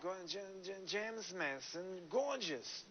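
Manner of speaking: a lightly done James Mason, slow and drawn out; James Mason impression. Host imitating James Mason for the actress, slow and drawn out. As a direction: put on a hint of James Mason, slowly. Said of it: "This is a really good James Mason".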